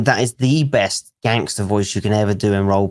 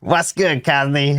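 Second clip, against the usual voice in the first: lightly imitated high greeting; catchphrase imitation; 3 semitones higher, slower than usual. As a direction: echo a character's catchphrase, pitched up. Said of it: "this must be one of yours as well".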